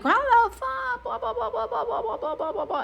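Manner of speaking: squealed caricature of someone ranting; imitated screaming rant. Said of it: "he's screaming at me".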